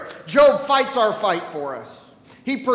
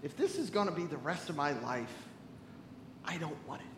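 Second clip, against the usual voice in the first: quiet inner despair; 6 semitones lower, 15 dB quieter, faster than usual. Retold or imitated. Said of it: "I thought to myself".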